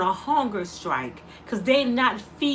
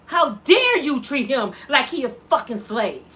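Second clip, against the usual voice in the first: ordinary talk, nothing marked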